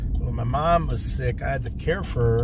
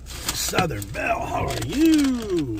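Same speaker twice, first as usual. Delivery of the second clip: slow drawn-out high call; 8 semitones higher, slower than usual. Real, not acted. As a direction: call out across the lot. Calling out to coworker.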